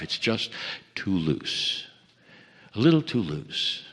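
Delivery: quiet, dismissive murmur of a doubter; doubtful skeptic. Retold or imitated. Teacher quoting a skeptic about love, low and dismissive. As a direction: mutter it like you're unconvinced. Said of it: "there are those who say it's not good enough".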